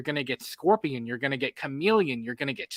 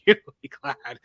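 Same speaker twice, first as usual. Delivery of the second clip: laughing; 9 semitones higher, 5 dB louder than usual. Real, not acted. Laughs while saying it.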